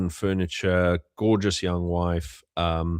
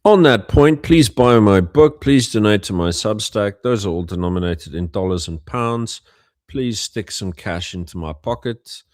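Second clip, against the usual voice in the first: mock plea; 2 semitones higher, 7 dB louder than usual. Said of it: "mock begging for money".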